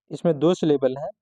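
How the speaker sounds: usual speaking voice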